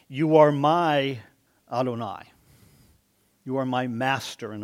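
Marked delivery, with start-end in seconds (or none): 0.1-2.3 louder, very slow "You are my", then "Adonai"; slow down and proclaim "You are my Adonai"; stress on my Adonai. Shown as a demonstration. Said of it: "you notice he says to Yahweh".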